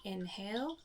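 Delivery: soft, unhurried meditation voice with long pauses; soft calm phrases, spaced out by silence; guided meditation. Acted. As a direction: guide listeners gently through each breath. Leads a guided breathing meditation.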